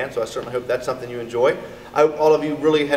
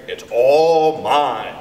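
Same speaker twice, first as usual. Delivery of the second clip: possessive; 5 dB louder, much slower than usual. A narrator's reading. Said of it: "said Harriet".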